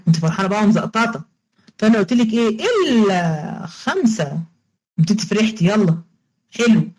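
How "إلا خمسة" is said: إلا drawn out slowly and stressed, falling; teacher stretching إلا out slowly, word by word; slow stress on illa. Shown as a demonstration. Teacher to students, stressing إلا slowly. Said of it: "teacher drills the exceptions".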